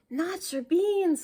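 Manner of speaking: high, loud, slow outraged cry. Acted. Little dog crying out over his beans, outraged. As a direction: wail it in shocked outrage. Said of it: "cried Scipito, outraged".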